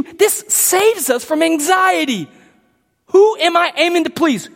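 ordinary talk

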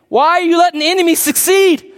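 acting out a pleading lament; pleading complaint. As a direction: cry out in protest to God. Voicing the psalmist's complaint to God.

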